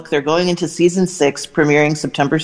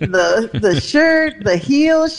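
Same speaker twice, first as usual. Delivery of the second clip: imitating Dolly; 6 semitones higher, much slower than usual. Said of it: "She goes".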